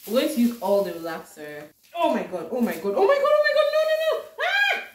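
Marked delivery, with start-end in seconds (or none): 1.9-4.2 rising to a high, loud squeal; panicked. Real, not acted. Vlogger watching her relaxer spill, panicked. 4.4-4.8 short high, loud shriek; panicked scream. Real, not acted. Vlogger as the relaxer spills, shrieking.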